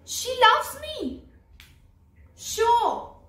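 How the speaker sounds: high, loud burst of discovery; startled realisation. Acted. Realising that she loves me, startled stage exclamation. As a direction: exclaim it as it suddenly dawns on you.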